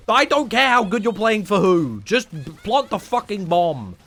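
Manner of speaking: loud, high exasperated yell; mock rant. Streamer ranting at Valorant couples, loud exasperated yell. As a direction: blow up at them to just plant it.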